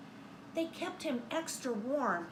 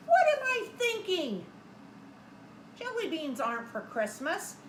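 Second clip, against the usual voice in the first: surprised Santa; 6 semitones higher than usual. Acted. Santa exclaims in story.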